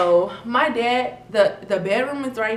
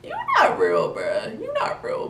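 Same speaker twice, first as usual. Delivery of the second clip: incredulous. Retold or imitated. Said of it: "But I'm like".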